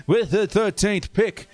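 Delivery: slow draft-podium announcement with an odd put-on accent; Roger Goodell impression. Streamer mock-announcing the Chargers pick, slow with a put-on accent. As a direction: stretch it out like the commissioner at the podium, slipping into some accent. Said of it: "why did I go into an accent".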